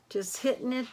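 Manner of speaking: ordinary delivery, nothing marked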